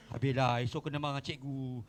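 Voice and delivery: low, muttered mock dread; worried pupil. Preacher playing pupil expecting teacher's scolding, low mock dread. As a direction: drop far down in pitch, glum and resigned.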